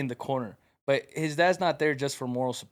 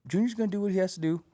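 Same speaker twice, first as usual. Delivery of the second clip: a lightly raised, mellow dad voice; mock the calm old man's way of talking; imitating his dad; 6 semitones higher, faster than usual. Teammate imitates the chill dad in the corner. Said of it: "Why you gotta say it like that?".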